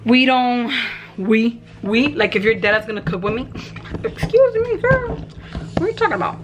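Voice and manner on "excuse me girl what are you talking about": talking back to someone off camera, sassy and high; sassy call-out. Real, not acted.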